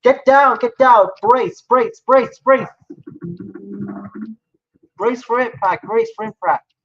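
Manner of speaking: high, loud yelled brace commands; bracing for a crash. Acted. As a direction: scream at passengers to get down. Crash landing, crew yelling commands.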